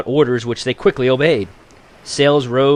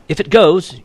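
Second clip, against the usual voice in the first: higher, louder retort; shouted back; 8 semitones higher, 5 dB louder than usual. A narrator's reading. Ulape teasing Nanko on the cliff, shouting back. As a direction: fling it back at him with a shout. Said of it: "Ulape shouted back".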